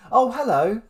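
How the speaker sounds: questioning hello, then a high, loud one; put-on phone greeting that swells into mock surprise; pretend phone surprise. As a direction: feign surprise at who is calling. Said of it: "Like I didn't know it was".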